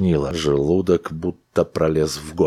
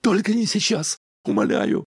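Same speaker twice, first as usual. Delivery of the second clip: pleading. A narrator's reading. Wife pleads on waking.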